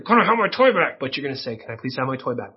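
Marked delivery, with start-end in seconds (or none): 0.0-1.0 mock-angry demand, higher and growled. Shown as a demonstration. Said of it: "You're not gonna get angry". 1.6-2.6 soft, low, polite request; self-controlled. Shown as a demonstration. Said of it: "with self-control".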